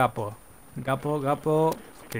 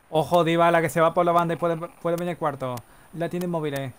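higher-pitched running match commentary; excited play-by-play; 4 semitones higher, 4 dB louder than usual. Pretend. Commentator following Dybala down the wing, excited. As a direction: call the attack as it builds.

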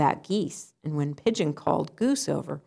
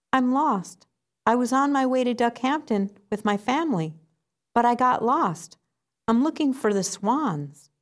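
small duck voice pitched higher, anxious; lost little duckling; 7 semitones higher than usual. Acted. Little duck tells pigeon he is lost, pitched higher and anxious. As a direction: sound like a lost duckling asking for help.

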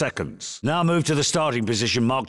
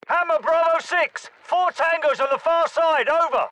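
shouted radio call. Acted. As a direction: yell into the mic over noise. Radio call under fire.

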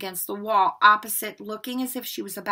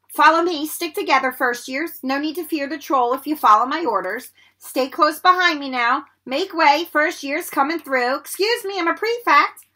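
self-important, raised voice, higher and louder; bossy prefect; 6 semitones higher, 6 dB louder than usual. A narrator's reading. Prefect herding first years away from a troll, bossy. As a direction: take charge like an officious prefect.

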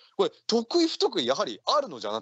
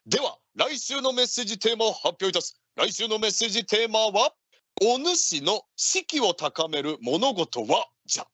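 radio announcer; 5 semitones higher than usual. Acted. Announcing next theme.

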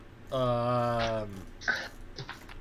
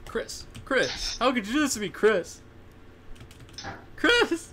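rising louder, higher hammed-up wail of the name; mock betrayal; 10 semitones higher, 5 dB louder than usual. Gamer wailing at a teammate who left him, building hammed-up cry. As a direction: play the betrayed friend, building to a shriek.

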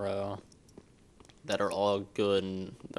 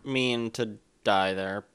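frustrated; 3 semitones higher, 5 dB louder, slower than usual. Real, not acted. Dies in game, frustrated.

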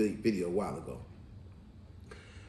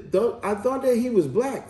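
lightly voiced mother, higher pitch; higher-pitched quoted objection; mother's doubt; 8 semitones higher than usual. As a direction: imitate a mom checking with her son. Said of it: "And then the mother said".

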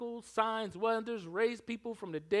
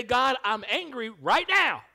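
loud, raised, heated plea; honest angry prayer; 5 semitones higher, 12 dB louder than usual. Retold or imitated. Believer telling God their anger out loud. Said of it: "It's okay to say".

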